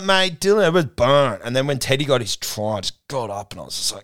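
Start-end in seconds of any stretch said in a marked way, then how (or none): 0.0-1.4 gloating taunt. Retold or imitated. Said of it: "And I was like".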